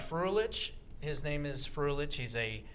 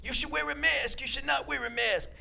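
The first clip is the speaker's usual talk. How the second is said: put-on high New York accented voice; Fauci imitation; 10 semitones higher than usual. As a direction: redo the flip-flopping doctor. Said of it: "Do that again".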